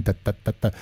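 soft da syllables on the beat; quietly voicing the beat; vocal rhythm demo. Shown as a demonstration. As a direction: sing the strum pattern quietly. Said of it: "very on this jackhammer thing".

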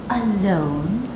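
plain reading voice

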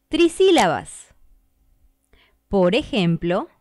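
normal talking voice

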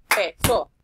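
"Peso" loud with PE punched; stress on PE. Shown as a demonstration. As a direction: hit the first syllable of "Peso". (acento en la sílaba PE de "Peso")

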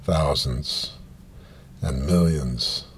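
usual speaking voice

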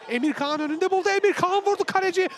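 loud, high goal call; screaming football commentator. Real, not acted. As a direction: yell the goal as it goes in. Second goal scored live.

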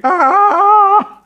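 very high, loud held shriek; sustained scream. Retold or imitated. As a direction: belt out a long siren wail. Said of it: "sambil jerit". (jeritan panjang yang sangat tinggi dan kuat)

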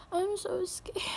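hushed and scared, barely voiced; whispering fear during a thunderstorm. Real, not acted. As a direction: whisper it, frightened.

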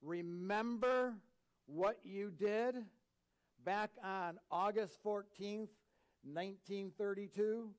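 loud, slow, drawn-out questioning; God bringing up sins. Acted. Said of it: "and he then says".

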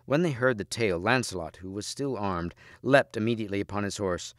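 ordinary delivery, nothing marked

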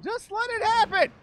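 high, loud insistence; impatient shout at an opponent; exasperated yell. Real, not acted. Urging repeated, escalating.

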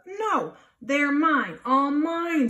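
high, loud, slow possessive scream. Acted. Grouchy ladybug screaming over the aphids. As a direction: scream it like a greedy child. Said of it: "screamed the grouchy ladybug".

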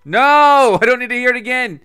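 high, loud no, then hurried refusal; real impatient outburst; exasperated protest. Real, not acted. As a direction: cut them off in loud exasperation. Exasperated at repeated game dialogue.

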